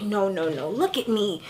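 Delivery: low, soft imitation of an earnest denial; quietly insist, as if imitating someone; hushed denial.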